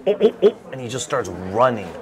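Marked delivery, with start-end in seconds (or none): 0.1-0.6 high, short mock yelps; dad's panicked yelps. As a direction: yelp like a man fleeing his wife.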